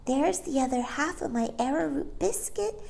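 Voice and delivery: a hint of a baby sharing; lightly voiced baby. Barbara offers biscuit.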